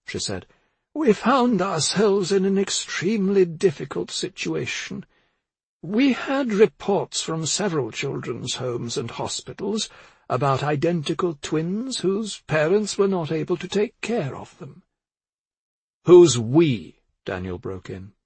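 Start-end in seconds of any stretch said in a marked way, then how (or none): 0.9-14.8 put-on high, measured old woman's voice; explaining old woman. A narrator's reading. 16.0-17.0 loud, sharp interruption; angry, hateful. A narrator's reading. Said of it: "his voice was angry and full of hate".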